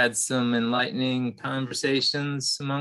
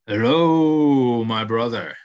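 buffalo voice; 7 dB louder, slower than usual. Acted. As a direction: greet him like a gentle giant. Buffalo greets the mouse.

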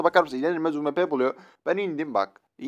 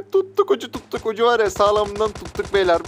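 high, loud glee; excited; 7 semitones higher, 5 dB louder than usual. Real, not acted. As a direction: crow about the win. Excited after laughing. (heyecanla, yüksek sesle)

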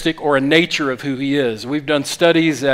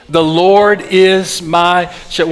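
slow, louder, every word given weight; slow group recitation; 2 semitones higher, 4 dB louder, slower than usual. Shown as a demonstration. Pastor leading the congregation in the psalm, slow and emphatic. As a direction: lead the room through the verse word by word. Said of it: "would you say it with me?".

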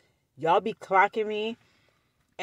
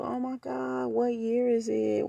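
higher, softly drawn-out mock awe; mimicked nosy cousin; 6 semitones higher, 3 dB quieter, slower than usual. Cousin asking the car's year, lightly mocked awe. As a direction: lightly imitate a gushing questioner.

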